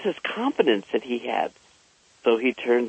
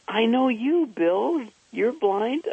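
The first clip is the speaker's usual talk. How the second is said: put-on child voice; 9 semitones higher, slower than usual. Child answering, voiced by teller.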